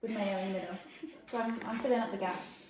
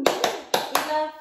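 excited; 6 semitones higher, 12 dB louder than usual. Real, not acted. Hyping the video.

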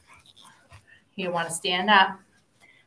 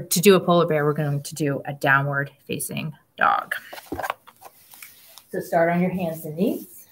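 usual speaking voice

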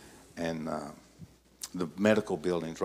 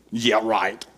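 put-on loud, disbelieving kid scoff; sarcastic retort; 2 semitones higher, 12 dB louder than usual. Boy answering his mother, loud and sarcastic. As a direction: scoff like a boy who doesn't buy it.

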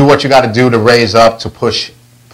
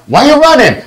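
yelling at son; 14 semitones higher than usual. Retold or imitated. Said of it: "he's a total running around".

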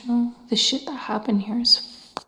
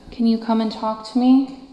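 usual speaking voice

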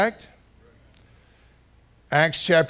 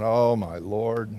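slow, lower groan of dismay; dismayed aside; 4 semitones lower, much slower than usual. Retold or imitated. Pastor watching the young man refuse her, slow dismayed groan. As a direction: drag it out, dreading the scene. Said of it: "And I'm, like, going".

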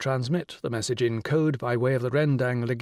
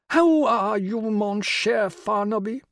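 grand regal lady, pitched high and louder; rich royal voice; 9 semitones higher, 4 dB louder, slower than usual. Acted. The Rani greeting Will on the phone, rich and regal. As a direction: sound like a queen condescending down the phone. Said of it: "the rich royal voice".

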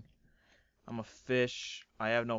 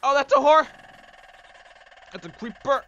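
high, louder startled call; alarmed cry; 12 semitones higher, 13 dB louder, faster than usual. Real, not acted. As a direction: spot danger and yelp. Spots a creeper.

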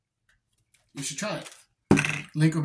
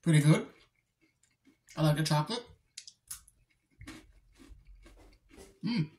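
talking while chewing snack; muffled by food in mouth; mouth full, chewing; faster than usual. Real, not acted. Seen 1: Holds chocolate sticks, bites and chews between words throughout.

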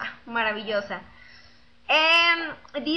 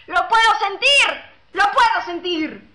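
más fuerte y agudo, exaltado; emocionado; 5 semitones higher, 7 dB louder than usual. Real, not acted. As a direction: se emociona como ante un público. Siente las ovaciones, exclama. (emocionado al sentir las ovaciones)

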